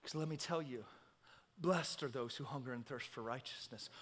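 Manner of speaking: very soft, low sermon voice; hushed preaching. Real, not acted. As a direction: bring the congregation down to a hush.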